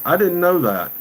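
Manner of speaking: high, loud burst of surprise; surprised. Real, not acted. Streamer learns a fact from a viewer's comment, surprised. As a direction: react with a sudden jump in pitch and volume.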